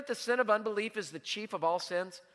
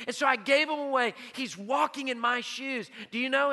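loud, higher-pitched pulpit delivery; impassioned preaching; 5 semitones higher, 6 dB louder than usual. Real, not acted. Pastor to congregation on joy in giving, impassioned preaching. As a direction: preach it out with fervor.

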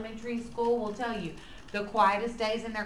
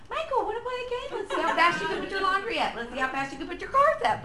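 fast, higher, laughing singsong; imitating singsong mom; 8 semitones higher, faster than usual. Son mocks mom's coaxing game pitch, fast singsong. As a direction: son mimicking his mother's coaxing game voice. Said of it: "you saying".

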